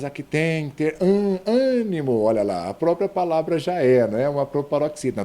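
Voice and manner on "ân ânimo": "ânimo" high and loud on ÂN; spirited first-syllable stress. Shown as a demonstration. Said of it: "para pronunciá-las". ("ânimo" com o ÂN forte e agudo)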